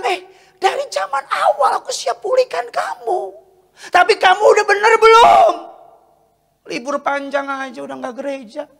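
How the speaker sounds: high, impassioned rebuke; God scolding back. Retold or imitated. God replying to a complaining believer, high scolding voice. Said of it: "Tuhan bilang".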